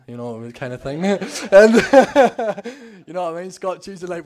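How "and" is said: real loud laugh. Real, not acted. Laughs at Scott story.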